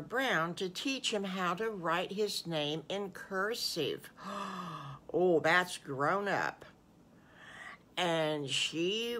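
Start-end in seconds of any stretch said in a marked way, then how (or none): 4.2-5.1 soft gasp of wonder; amazed gasp. Acted. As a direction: draw in breath as if impressed. Storyteller gasps in mock amazement.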